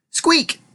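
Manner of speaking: tiny high-pitched squeak; shrill mouse squeak. A narrator's reading. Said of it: "screamed the mouse".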